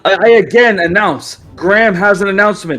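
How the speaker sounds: loud, high herald calling the room; yelled announcement. Acted. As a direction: stand on the table and cry out for attention. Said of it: "as Mayvel yells and goes".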